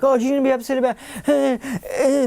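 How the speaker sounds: high mock fretting; mock worry. Mocking a man who sees a threat, fretful. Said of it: "viewed her as a potential threat".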